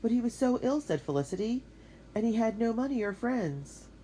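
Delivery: worried. Acted. Worried protest about ill man.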